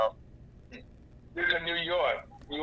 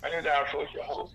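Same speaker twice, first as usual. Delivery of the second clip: normal talking voice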